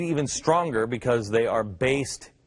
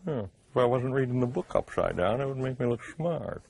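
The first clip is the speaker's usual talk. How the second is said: lightly hammed-up English accent; mock English accent; 3 semitones lower, 5 dB quieter than usual. Host joking after his reading, mock English accent. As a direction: stay in the put-on English voice. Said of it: "let me say it with an English accent".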